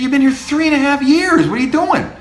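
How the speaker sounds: incredulous teacher. Retold or imitated. Teacher retelling his own reaction.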